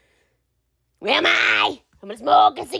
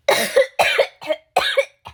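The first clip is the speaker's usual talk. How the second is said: hacking cough; 10 semitones higher than usual. Acted. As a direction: cough hard like a heavy smoker. Smoker's coughing fit.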